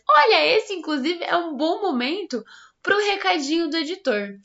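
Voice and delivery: high, loud excited call; excited shout. Real, not acted. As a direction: call out to the editor, thrilled. Calling out to editor.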